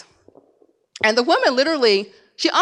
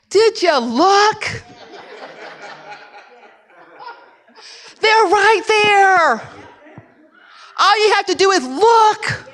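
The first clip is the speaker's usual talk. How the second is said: put-on exasperated, half laughing at the kid; exasperated parent; 7 semitones higher, slower than usual. Parent to a kid who didn't find the glasses, mock exasperated. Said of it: "Here they come back".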